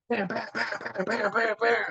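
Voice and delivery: high rapid bam bam knocks; imitated door banging. Locked out at night, banging on the door with mock knocks. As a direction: pound out the knocks with your voice.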